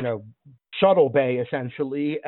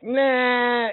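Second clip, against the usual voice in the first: high, drawn-out "Nah"; dismissive; 11 semitones higher than usual. Acted. Game master voices man giving up on his beard, dismissive. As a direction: hold it long and high, waving it off. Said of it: "then he's like".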